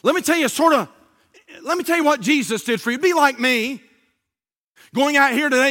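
lightly voiced Bible school teacher greeting children; talking to kids. Shown as a demonstration. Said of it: "I'll say something like this".

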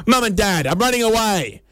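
put-on high-pitched child's announcement; kid running away. Kid telling parents they are leaving, high and proclaiming. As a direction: call it out high like a defiant kid.

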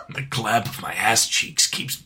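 low raspy whisper; hushed, mock-serious stealth voice; gruff action-hero parody. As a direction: whisper it like a soldier in hiding. Solid Snake meme parody, whispered.

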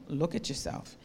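quiet, low and kind; soft, low master. Acted. Potter quietly invites the teacup to look, gently. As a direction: hand it over gently, softly.

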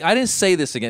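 normal talking voice

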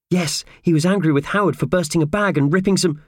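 high character voice, reporting events; earnest Purvis. Acted. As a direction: relay the news in a small high voice.

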